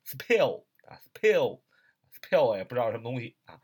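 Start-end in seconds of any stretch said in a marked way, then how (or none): 0.1-2.6 "spell" with a puffed, aspirated p, like "s-pell"; mis-say "spell" with a strong puff of air on the p; learner's mistake, "spell" with a breathy p; wrong aspirated p. Shown as a demonstration. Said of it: "容易一开始的时候拼成spell".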